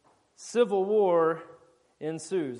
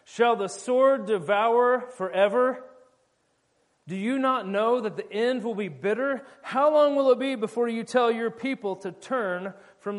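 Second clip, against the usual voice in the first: calling across; 4 semitones higher, 5 dB louder than usual. Retold or imitated. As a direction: call out across the hill to the enemy. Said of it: "he calls to Joab".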